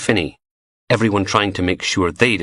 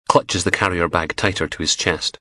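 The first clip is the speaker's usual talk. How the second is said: ordinary delivery, nothing marked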